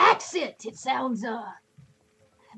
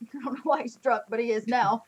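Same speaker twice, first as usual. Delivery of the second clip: usual speaking voice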